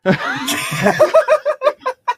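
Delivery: high shouted laugh; shrieking laugh. Real, not acted. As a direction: squeal with laughter. Laughs at "shiny.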